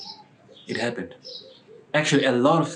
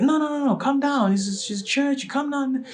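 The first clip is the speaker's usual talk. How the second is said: very high, hurried pleading; urgent placating. Retold or imitated. Inviter telling offended woman to calm down, urgent placating. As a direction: try to talk someone down, pitched way up. Acts out calming her down.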